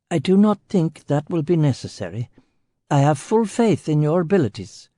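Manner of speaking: a lightly voiced stiff Finnish official; formal Finnish official. Finnish delegation head voiced.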